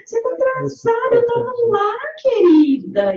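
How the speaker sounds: sweet on top, barbed underneath; sugary passive-aggressive. Acted. Preacher acting a colleague hiding anger, falsely sweet.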